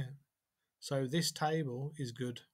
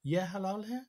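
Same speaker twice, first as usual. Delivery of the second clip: "Ye halal hai" raised, rising on hai; question by intonation; 5 semitones higher than usual. Shown as a demonstration. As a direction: ask "Ye halal hai" by tune alone. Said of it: "it can be a question if it's intonation".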